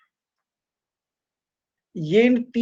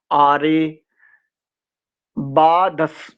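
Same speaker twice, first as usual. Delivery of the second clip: normal talking voice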